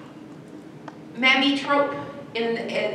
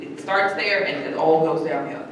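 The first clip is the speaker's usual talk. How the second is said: usual speaking voice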